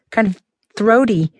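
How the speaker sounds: ordinary delivery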